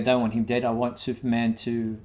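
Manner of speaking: ordinary talk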